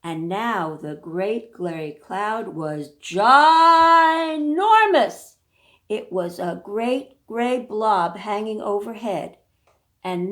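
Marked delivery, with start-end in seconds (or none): 0.0-5.4 slow, swelling narration on ginormous; dramatic build. A narrator's reading. Reader making the cloud sound huge. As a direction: stretch out ginormous with awe.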